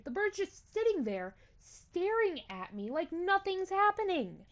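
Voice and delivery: ordinary talk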